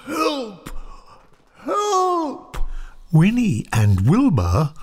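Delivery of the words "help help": high, thin call from far off; muffled cry for help. A narrator's reading. Trapped Santa calls from inside the chimney, high muffled cry. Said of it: "shouted a voice from the chimney".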